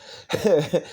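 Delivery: real laugh after reading the line; brief spontaneous laugh; amused chuckle. Real, not acted. Amused by verse about laughing.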